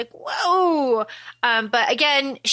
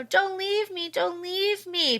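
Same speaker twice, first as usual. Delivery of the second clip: pleading; 6 semitones higher, 5 dB quieter, slower than usual. Retold or imitated. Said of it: "And she's, you know".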